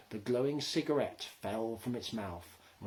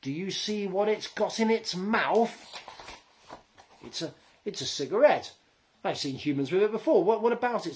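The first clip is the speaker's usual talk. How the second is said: frightened; 8 semitones higher, 7 dB louder than usual. Acted. Gnomes watch approaching human.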